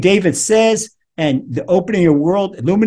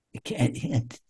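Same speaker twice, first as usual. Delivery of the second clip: choked whisper; 3 semitones lower, 9 dB quieter, slower than usual. Acted. Acting out inability to speak.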